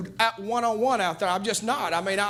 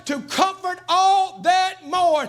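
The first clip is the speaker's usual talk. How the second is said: preaching at full force; 4 semitones higher than usual. Acted. Preacher rousing the church about real preachers, full-force preaching. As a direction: thunder it out to the church like a revival preacher.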